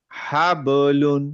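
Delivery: হাবলুন drawn out slowly with an echoing bounce on ব; slow qalqala demo. Shown as a demonstration. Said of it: "এই ধরনের এরকম হচ্ছে".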